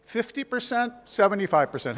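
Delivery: ordinary talk, nothing marked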